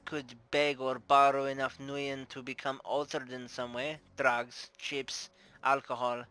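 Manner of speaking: put-on Russian-accented madam telling what she knows. Bar owner recalling the dead regular, Russian accent. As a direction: recount it with a theatrical Russian accent. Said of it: "I'm doing a Russian accent".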